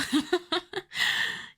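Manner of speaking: a high, gleeful predatory cackle; mocking high laugh. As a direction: laugh at him as a cat toys with a mouse. Mocking the ignorant boy.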